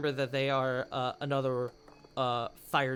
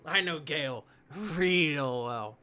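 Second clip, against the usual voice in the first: sly townsfolk; 4 semitones higher, 6 dB louder than usual. Acted. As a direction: lean in with a smirk. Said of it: "a couple people who are like".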